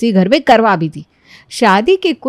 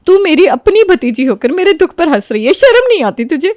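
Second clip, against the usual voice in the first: high, louder offended rebuke; angry scolding; 6 semitones higher, 3 dB louder than usual. Acted. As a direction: shame her for laughing at your grief. Aunt scolds niece for laughing.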